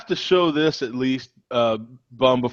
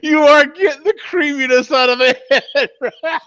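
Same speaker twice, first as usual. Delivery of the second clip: laughing; 13 semitones higher, 3 dB louder than usual. Real, not acted. Laughing at innuendo joke.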